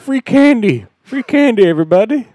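high, loud singsong advertising; play-acted creepy vendor shouting; mock hawker's call. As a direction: call out to the whole street. Mimics a creep's van sign.

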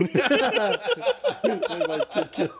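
very slow, high, dissolving into laughter; laughing repeat. Real, not acted. Teller repeats his friend's joke while laughing hard. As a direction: savor the joke while cracking up.

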